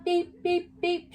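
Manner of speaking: high stiff beeps; robot beeps. Acted. Winnie the robot beeps as she walks around the garden, high and stiff. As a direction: beep like a little robot. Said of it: "she said".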